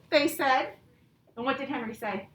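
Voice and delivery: slow, expressive read-aloud to a class; reading to children. Acted. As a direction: read it like a picture book to second graders. Teacher reads story aloud.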